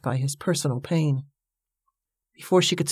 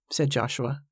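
ordinary delivery

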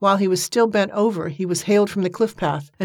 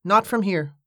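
firm; 3 semitones higher than usual. A narrator's reading. Joshua contradicts the sergeant about the body, firmly. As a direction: close the matter without raising your voice. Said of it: "Joshua said firmly".

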